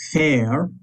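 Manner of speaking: "fair" said with a long fada vowel like English air; Irish word féar. Shown as a demonstration. Teacher demonstrating the long fada A in féar to the class. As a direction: stretch "fair" into a long air sound, rising. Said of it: "the long sound of the A, which is air".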